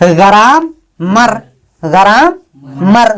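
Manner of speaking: "ग्राम" rising, pause, then "मर"; "ग्राम ... मर" sounded out with gaps; two syllables. Shown as a demonstration. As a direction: alternate "gram" and "मर" as separate syllables. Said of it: "दो syllable में".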